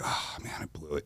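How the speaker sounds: whispered to himself with head hung low; a defeated, near-silent whisper; dejected. Retold or imitated. Midshipman walking out after blowing his chance, dejected whisper. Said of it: "Man, I'm dejected".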